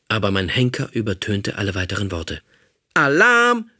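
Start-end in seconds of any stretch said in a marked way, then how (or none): 2.9-3.7 high, loud alarm cry; robot screams alarm. Acted. Robot captor raising the alarm to the others, loud high scream. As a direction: shout the alarm at full pitch. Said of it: "schrie er".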